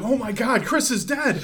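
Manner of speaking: high, loud shocked gasp of news. Retold or imitated. Roommate waking him with shocking news. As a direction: blurt out terrible news. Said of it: "He's like".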